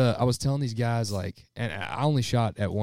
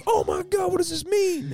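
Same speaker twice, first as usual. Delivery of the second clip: much higher, loud astonished yell; excited shout; 6 dB louder than usual. Retold or imitated. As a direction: shout it like your first banded bird. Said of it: "And he was like".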